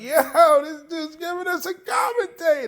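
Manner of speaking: high, loud cop calling out; laughing cop. Retold or imitated. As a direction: cop mocking the narrator to his partners, high and loud. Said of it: "He's like".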